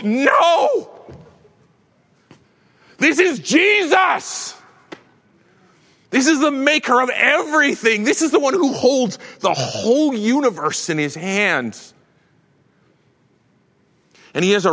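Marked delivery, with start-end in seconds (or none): 0.0-11.9 much higher, shouted, then easing; awestruck cry. Retold or imitated. John fallen on his face before Jesus, shouting in awe. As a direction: cry out overwhelmed, then settle.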